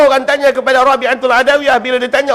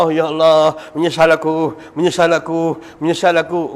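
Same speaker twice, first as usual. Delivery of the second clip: low, rueful moaning of regret; regretful old man; 8 semitones lower, 4 dB quieter than usual. Retold or imitated. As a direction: groan out your regret like a sinner caught too late. (rintihan penyesalan yang rendah)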